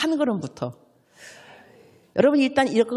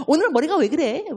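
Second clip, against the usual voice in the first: much higher, exasperated tut-tutting; nagging critic; 6 semitones higher than usual. Retold or imitated. Fault-finding churchgoer criticizing someone's tie, high and exasperated. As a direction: scold the tie the moment you see it.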